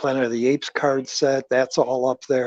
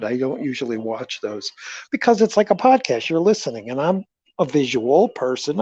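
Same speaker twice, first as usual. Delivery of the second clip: usual speaking voice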